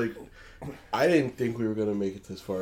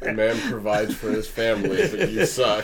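words through laughter; laughing while talking; 4 dB louder than usual. Real, not acted. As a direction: laugh as you say it.

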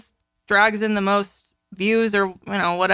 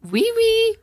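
higher mock French Oui, oui; 12 semitones higher than usual. Teasing the dog's French voice, higher mock French. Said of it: "We say as French words".

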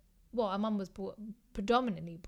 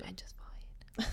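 slow hushed confession; whisper; 8 dB quieter, slower than usual. Real, not acted. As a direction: whisper it slowly so only she hears. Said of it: "You should have said that louder".

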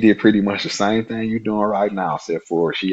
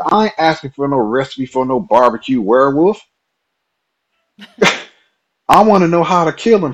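louder, higher protest with a laugh; indignant; 6 semitones higher, 4 dB louder than usual. Retold or imitated. Pushing back at the coworker he thinks mocks him, indignant and loud. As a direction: insist you're not joking around. Protesting he's being mocked.

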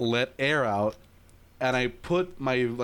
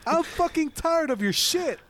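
mock rant at teacher; 11 semitones higher than usual. A student ranting at the teacher who scolded him for yawning, in a high mock whine. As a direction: squeal it like a fed-up teen. Retelling yawn complaint to teacher.

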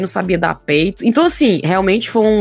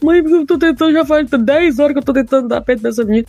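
crying plea; 8 semitones higher than usual. Retold or imitated. New mother retelling her desperate plea to her mother, tearful. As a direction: imitate yourself crying to your mom. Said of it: "e eu chorando".

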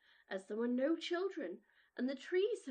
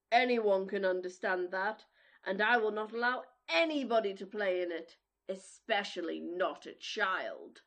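gruff giant voice; 5 semitones lower, 7 dB louder than usual. Acted. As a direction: boom it like a selfish giant. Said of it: "said the giant".

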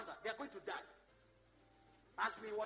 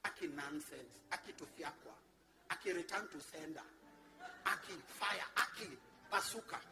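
mocking complainer; 7 semitones lower than usual. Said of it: "you will just be saying".

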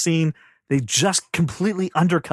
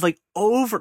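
ordinary delivery

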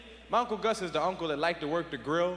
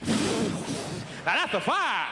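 slow proud drawl; 3 semitones higher, much slower than usual. Retold or imitated. Uncle admires the huge fire.

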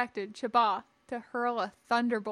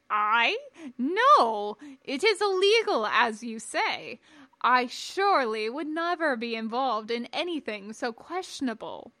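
feigned innocence; 5 dB louder than usual. Acted. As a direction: protest too much, then settle down. Cornered man feigns shocked innocence.

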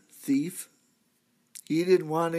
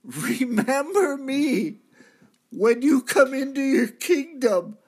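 crying out; 9 semitones higher, 6 dB louder than usual. Acted. Dying thief begs Jesus on the cross, loud high cry. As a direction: call out desperately, pitched up and loud. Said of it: "And I cried out".

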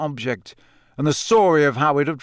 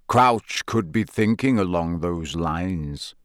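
slow and low, weighing every word; slowly; 7 semitones lower, slower than usual. A narrator's reading. Moody, pondering aloud to Harry, slow and low. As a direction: draw it out, deep in thought. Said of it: "he said slowly".

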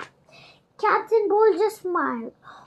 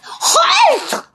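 high, sudden shouted command; 11 semitones higher, 4 dB louder than usual. A narrator's reading. Captain stopping children backing away, shouting. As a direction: stop them with one shout. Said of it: "Captain Bones shouted".

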